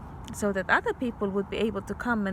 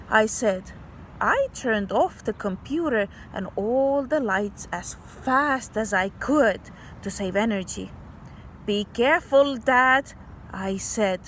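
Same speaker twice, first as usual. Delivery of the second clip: put-on bright read-aloud voice for kids; story reading; 3 semitones higher than usual. Teacher reading a story to kids, storybook voice. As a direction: read the picture book to children. Reads story with character quotes.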